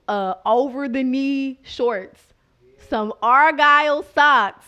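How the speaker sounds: "over the knee shorts" and "Argyle socks" stretched slow and loud; drawn-out emphasis. Real, not acted. Comic listing dad's embarrassing outfit, loud stretched "Argyle socks".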